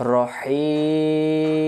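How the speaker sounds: রহিম with the i drawn very long; three alif stretch. Shown as a demonstration. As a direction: stretch the i of রহিম in steady recitation. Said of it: "তিন আলিফ টানতে হয়".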